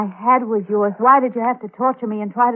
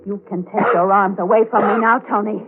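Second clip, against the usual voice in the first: ordinary delivery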